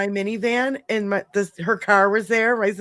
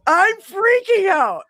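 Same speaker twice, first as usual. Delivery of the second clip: high, loud, slowed panic on "freaking"; 10 semitones higher, 5 dB louder, slower than usual. Retold or imitated. Telling friends about the stolen car, pitched-up loud panic. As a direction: relive the panic, voice jumping up and stretched out.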